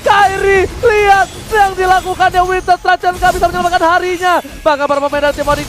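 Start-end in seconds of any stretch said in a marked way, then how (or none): 0.0-4.4 shouted esports call; fighting game announcer. Real, not acted. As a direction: yell the big play out. Caster shouts big play.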